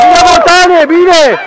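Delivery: high-pitched shout of praise; cheering. Real, not acted. Coach praises Botan during play, cheering. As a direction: call out approval across the court.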